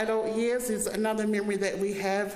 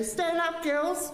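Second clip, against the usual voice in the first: high, sing-song tease to get a rise; teasing call; 8 semitones higher, slower than usual. Retold or imitated. Cousins tease Les, high sing-song call. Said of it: "we walk in and we go".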